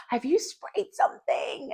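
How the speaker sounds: high startled accusing question; accusing surprise. Retold or imitated. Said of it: "and then I'm like".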